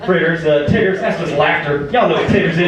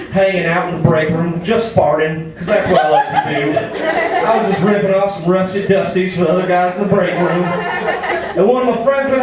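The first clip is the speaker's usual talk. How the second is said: put-on Rusty, cocky Southern good old boy. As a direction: play a rowdy Southern comic working the crowd. Plays Rusty the Southern comic.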